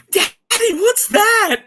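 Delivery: put-on scared little kid. Kid hears a gunshot and asks daddy, high frightened child voice. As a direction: squeal it like a small kid startled by a gunshot. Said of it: "and the kid goes".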